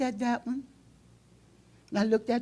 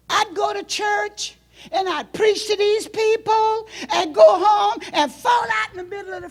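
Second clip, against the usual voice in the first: crying out loud; 9 semitones higher, 6 dB louder than usual. Acted. Preacher crying out to his congregation, loud and breaking.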